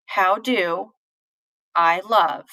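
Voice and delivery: "How do", "I love" in separated pairs; "How do... I love" as two iambs; stressed beats. Shown as a demonstration. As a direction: pause between pairs, leaning on "do" and "love". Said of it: "That shows where everything is stressed".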